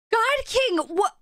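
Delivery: high, astonished outcry; overwhelmed shock. Real, not acted. Streamer reacting to God King's gift, overwhelmed. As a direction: gasp it out high in disbelief. Stunned by second big gift.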